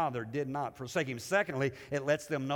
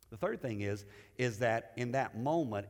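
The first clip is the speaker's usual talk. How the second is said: normal talking voice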